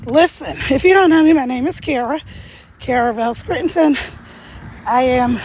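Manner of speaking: ordinary talk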